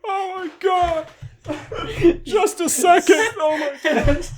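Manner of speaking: high, squeaky words through real laughter; helpless, tearful laughing; crying with laughter. Real, not acted. Reader begs friends for a second, crying with laughter. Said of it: "I'm crying".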